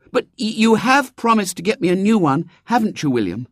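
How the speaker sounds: put-on high eager girl; a poor girl hoping; little girl voice. A narrator's reading.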